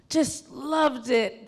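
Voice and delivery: ordinary talk, nothing marked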